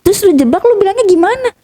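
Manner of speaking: high-pitched reenactment of a back-and-forth; animated retold exchange. Acting out the scheming phone exchange.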